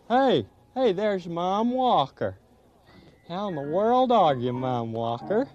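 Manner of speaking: deep cheerful owl calling hello; friendly owl voice. Acted. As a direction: boom out a hearty owl greeting. Greeting voiced by another puppet.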